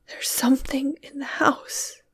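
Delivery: hushed, terrified whisper; awful voice. A narrator's reading. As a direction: breathe it out in dread, barely voiced. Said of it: "replied Jane in an awful voice".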